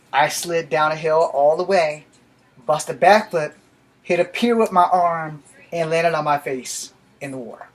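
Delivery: usual speaking voice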